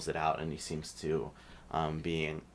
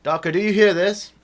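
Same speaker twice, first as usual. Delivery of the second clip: alarmed human voice; 13 semitones higher, 16 dB louder than usual. Acted. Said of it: "And he says".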